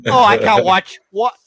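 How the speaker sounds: high, loud mock Thai accent; Thai accent impression. Imitating his Thai wife yelling at the footy, high loud mock Thai accent. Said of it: "she'll go in her Thai accent".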